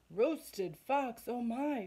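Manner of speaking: hammed-up fright; mock-scared fox. Fox hearing he is the food, mock terrified.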